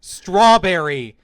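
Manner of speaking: acting out an uncle's frustrated shout. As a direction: yell it slowly at a machine that won't respond. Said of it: "he's just increasingly yelling in volume".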